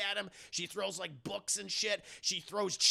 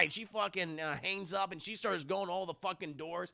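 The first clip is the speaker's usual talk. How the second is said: usual speaking voice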